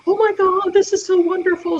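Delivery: high, loud starstruck gush; gushing fan. Retold or imitated. Worker gushing over meeting the vlogger, excited and high. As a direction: imitate the worker's delighted gushing. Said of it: "She's like".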